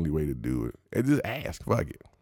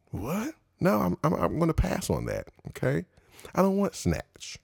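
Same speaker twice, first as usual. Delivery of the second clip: high, disbelieving no-thanks; raised pitch, playful disgust; incredulous refusal; 10 semitones higher than usual. Real, not acted. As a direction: back away from the offer in mock horror. Rejecting the term in disbelief.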